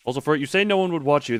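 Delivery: usual speaking voice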